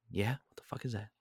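soft, fast, alarmed undertone; scared hush. Retold or imitated. Woken by a knock at night, a soft fast alarmed reply. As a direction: keep it down, someone's at the door.

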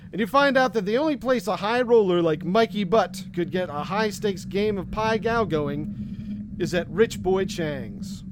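ordinary delivery

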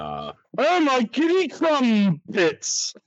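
slow, high, fussy exclamation; Doctor impression. In the impression-off, slow high fussy Doctor exclamation. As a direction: squeak it out slowly like a flustered Doctor. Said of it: "the fourth Doctor".

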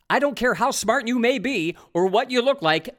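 normal talking voice

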